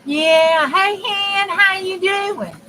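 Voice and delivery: put-on loud, high drawn-out greeting in a thick accent; hollering hey Hen in a hammed-up accent. Greeting Hen on camera, loud and high in a mock accent. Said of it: "My accent is not strong".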